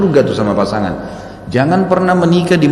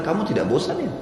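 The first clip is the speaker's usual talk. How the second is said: a wife's half-laughing, softer question; teasing laugh; 6 semitones higher, 7 dB quieter than usual. Retold or imitated. Preacher imitating a wife asking her husband, softly laughing. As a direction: tease him lightly through a chuckle. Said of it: "akan bilang".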